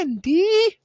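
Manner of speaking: very high, loud drawn-out cartoon voice; Family Guy impression. Host doing a running Family Guy bit, high cartoon call. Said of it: "You guys don't watch Family Guy like we do".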